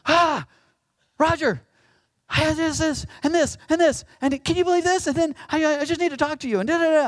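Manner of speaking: loud, high, frantic venting; excitable fellow. Acted. Excitable pastor unloading on his colleague next door, frantic. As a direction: pour it all out in an overexcited outburst. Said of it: "I can be kind of an excitable fellow".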